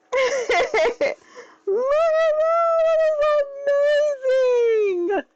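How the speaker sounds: ecstatic fangirl shriek; so excited. Real, not acted. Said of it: "I am so excited".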